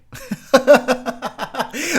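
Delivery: loud high burst of laughter; laughing. Real, not acted. Drunk reviewer cracking up at his Canadian impression, loud laugh. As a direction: crack up at yourself.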